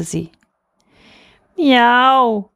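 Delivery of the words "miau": loud, high drawn-out meow; cat's meow. A narrator's reading. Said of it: "machte die Katze". (lautes, hohes Katzenmiauen)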